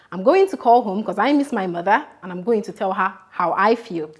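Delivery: ordinary talk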